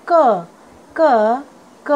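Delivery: क as a lone "kuh", punched; phonic letter sound. Shown as a demonstration. Teacher showing students the sound of K, phonic letter sound. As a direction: sound out क as K starts "kite". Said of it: "के का sound होता है".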